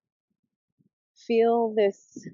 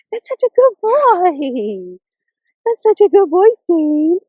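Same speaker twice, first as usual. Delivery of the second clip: doggy praise voice; 8 semitones higher, 10 dB louder, slower than usual. Real, not acted. Owner praising her dog Saint, slow high baby talk. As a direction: gush over him like a proud pet parent.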